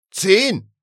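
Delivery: loud, high command; impatient high yell; insistent shout. A narrator's reading. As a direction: demand it at the top of your voice. Caller cuts in once more.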